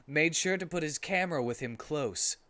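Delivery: ordinary delivery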